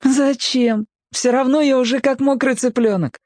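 put-on very high, childlike refusal; boy's voice. A narrator's reading. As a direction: voice a boy politely declining, pitched right up. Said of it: "мальчик сказал это". (высоким голосом мальчика)

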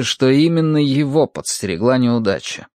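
usual speaking voice

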